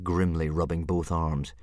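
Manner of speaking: plain reading voice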